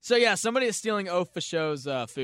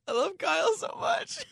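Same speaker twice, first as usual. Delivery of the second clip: laughing; 12 semitones higher than usual. Real, not acted. Laughing exclaim.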